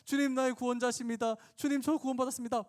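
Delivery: high, fervent mock confession; tearful convert. Typical convert falls before the Lord, high and overwrought. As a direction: cry out like an overcome new believer. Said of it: "눈물을 흘리면서 엎드러지고 쓰러져요".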